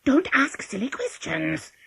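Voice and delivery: slow, high, loud scolding; angry false voice. A narrator's reading. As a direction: scold crossly while keeping the false pitch. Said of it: "said angrily, but still in his false voice".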